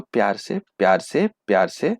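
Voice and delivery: soft and gentle coaxing of the answer; lovingly. Shown as a demonstration. Teacher softly coaxing students to answer lovingly. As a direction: coax the class softly, with love. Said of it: "तो बताओ सारे बच्चों".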